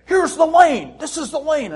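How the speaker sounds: excited, high-pitched booming sportscaster impression; high, punchy mock commentary shout; John Madden. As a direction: burst in loud and high like a football commentator. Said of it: "it was fun to impersonate John Madden".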